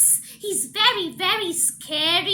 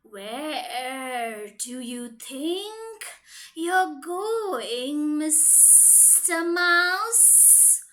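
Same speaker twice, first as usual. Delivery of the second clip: sly snake; slower than usual. Acted. Snake slowly lures the mouse to his log pile. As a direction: draw it out like a hungry snake.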